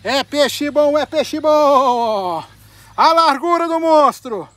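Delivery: loud, long-held celebratory call; triumphant catchphrase. Real, not acted. Angler showing off the monster fish, loud drawn-out shout. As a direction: shout it and stretch the endings way out.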